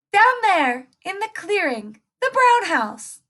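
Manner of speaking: put-on very high little girl pointing; girly voice. Little Red pointing wolf to Granny's house, very high girly. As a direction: chirp it sweetly.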